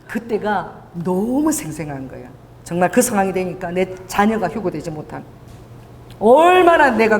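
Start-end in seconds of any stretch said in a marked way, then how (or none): none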